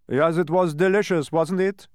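high, loud, delighted character voice; pleased dodecahedron. A narrator's reading. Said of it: "agreed the pleased dodecahedron".

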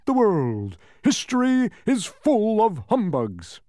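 high, loud, grandiose bluster; pompous Humbug, boasting. A narrator's reading. Humbug boasts of noble lineage, loud and pompous. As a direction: orate the family glories, drawing out endings.

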